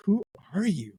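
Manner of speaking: high-pitched retold disbelief; mock incredulity. Al hearing Star Wars talk with the assistant, mock incredulity.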